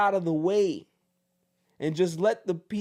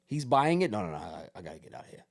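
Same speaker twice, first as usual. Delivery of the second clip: Belichick fleeing; 5 semitones lower, 5 dB quieter, much faster than usual. Retold or imitated. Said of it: "Bill Belichick says".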